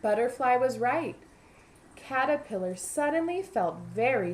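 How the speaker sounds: lively picture-book narration; storybook voice for young listeners; reading to children. A narrator's reading. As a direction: read it aloud to a class of kids.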